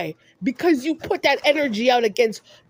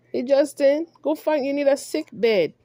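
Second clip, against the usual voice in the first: ordinary talk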